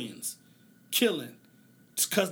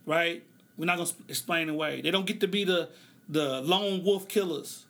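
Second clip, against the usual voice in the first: usual speaking voice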